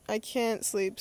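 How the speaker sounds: usual speaking voice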